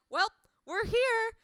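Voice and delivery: breezy robot professor voice; chirpy professor. Retold or imitated. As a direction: announce doom like it's good news. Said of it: "he was all like".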